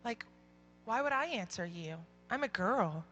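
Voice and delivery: softly imitated schoolgirl, low-key; quiet imitated girl. Girl telling her teacher why she won't answer, softly imitated. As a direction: retell her reply softly, falling off at the ends. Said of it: "she looked at me and she said".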